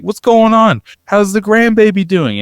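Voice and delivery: high-pitched loud yell across the rows; grandmother yelling down the plane. Retold or imitated. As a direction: shout it up to a daughter seventeen rows back. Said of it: "the top of her lungs yelling".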